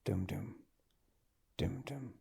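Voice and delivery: very soft, low thudding heartbeat; low heartbeat imitation. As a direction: imitate a faint heartbeat, barely audible. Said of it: "a low heartbeat sound".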